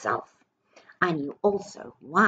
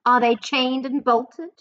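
witch demanding; 7 semitones higher, 5 dB louder than usual. Acted. Grand High Witch demands.

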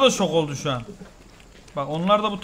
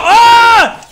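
sudden high "Aa!" of shock; surprised shriek; 14 semitones higher, 13 dB louder than usual. Real, not acted. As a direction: yelp at the surprise. Sudden chaos in the game.

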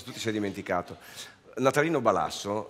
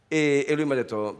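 normal talking voice

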